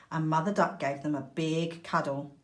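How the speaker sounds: ordinary delivery, nothing marked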